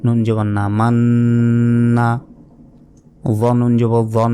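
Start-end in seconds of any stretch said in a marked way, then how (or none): 0.8-2.1 মান্না with the ন্ন held long and nasal; held nasal ghunna. Shown as a demonstration. Teacher to students, demonstrating ghunna with a slow nasal hold. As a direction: draw out the doubled ন in মান্না slowly through the nose. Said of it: "তাহলে গুণনা হবে".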